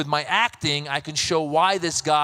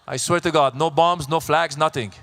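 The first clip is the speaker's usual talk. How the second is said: ordinary talk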